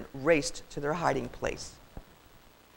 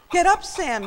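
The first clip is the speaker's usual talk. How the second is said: high, loud command; high-pitched yelled warning; urgent yell; 13 semitones higher, 7 dB louder than usual. A narrator's reading. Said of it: "yelled Soldier".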